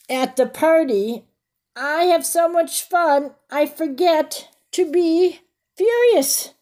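bright, lifted storybook reading; reading to children. A narrator's reading. Reading the picture book to the kids, lively storybook voice.

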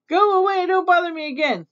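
high, mildly acted irritation; cross dismissal. Aunt Nasty refusing Little Witch's soup, crossly. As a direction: shoo her off in mock crossness.

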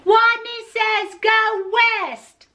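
high, slow, drawn-out holler; very strong voice. A narrator's reading. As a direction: holler the command, stretching each word. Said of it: "In a voice so strong".

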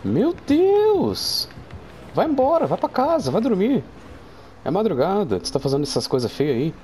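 hammed-up high-pitched mother telling her off; shocked mom scolding. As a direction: scold like an outraged mom sending a kid to bed.

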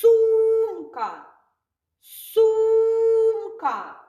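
high, loud, drawn-out "Сумка"; singsong teaching word; model word for children. Shown as a demonstration. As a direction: call out "Сумка" to little ones. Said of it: "teacher models word loudly".

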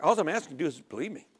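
quick, changed voice for God; hurried voice of God cutting off a believer's excuses. Retold or imitated. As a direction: speak as God, brushing the fuss aside. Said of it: "And God's just like".

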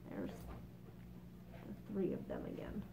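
unvoiced aside under the breath; a whisper to self while turning the page. Real, not acted. Reader's whispered aside to the listener.

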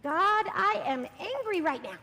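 slow, loud, firm angry prayer; angry prayer demo. Shown as a demonstration. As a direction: lead the angry line slowly and loudly. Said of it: "And we're gonna say".

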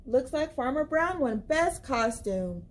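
plain reading voice

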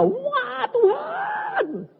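high, wailing yell; sudden desperate cry to God; screamed prayer. Retold or imitated. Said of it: "ada orang teriak". (jeritan melengking memanggil Tuhan)

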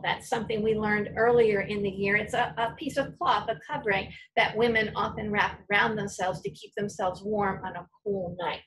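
normal talking voice

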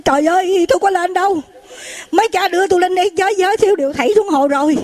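high, fast dismayed complaint; mock alarm, words rushing out; acted panicked protest. Frightened monk protests being thrown up.

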